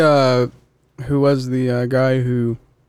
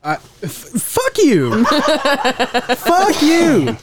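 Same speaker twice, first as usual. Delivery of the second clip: laughing; 11 semitones higher, much slower than usual. Real, not acted. Burning hot sauce, laughing curse.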